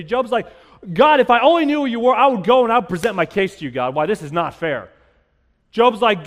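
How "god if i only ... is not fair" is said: loud outburst easing toward the end; hard-hitting complaint. Acted. Job presenting his case to God, loud protest. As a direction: protest to God like a wronged man. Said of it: "Job asks God some hard-hitting questions".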